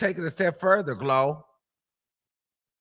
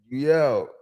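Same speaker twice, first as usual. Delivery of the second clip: normal talking voice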